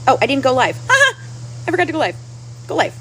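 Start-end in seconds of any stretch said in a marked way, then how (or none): 0.9-1.2 high burst of laughter at herself; embarrassed laugh. Real, not acted. Streamer realizing she never went live, sheepish high laugh. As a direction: laugh it off, caught out.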